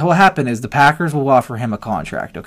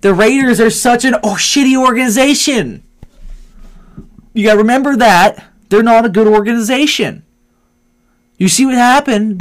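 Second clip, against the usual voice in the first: heated; 8 semitones higher, 5 dB louder than usual. Real, not acted. Heated argument about Raiders.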